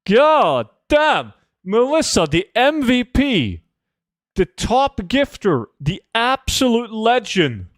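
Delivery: high-pitched celebration of the top gifter; hype. Real, not acted. Hypes a big gifter.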